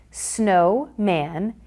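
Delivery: Snowman said very slowly; slow demo. Shown as a demonstration. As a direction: draw out each syllable for the class. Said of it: "Each cube will represent one syllable".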